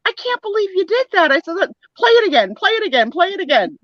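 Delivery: high, emotional retold plea; high-voiced, urgent repeated plea; overcome, pleading. Retelling hearing late mother's recording.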